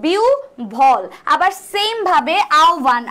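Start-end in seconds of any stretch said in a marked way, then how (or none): 0.1-1.0 "বিউভল" as biu-bhol, ব sounded as breathy ভ; correct pronunciation. Shown as a demonstration. Teacher models the word for exam students, "বিউভল" pronounced biu-bhol. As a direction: split "বিউভল" into lowered biu, then bhol. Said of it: "তাহলে বিউভল কি হয়ে যাবে".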